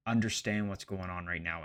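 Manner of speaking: usual speaking voice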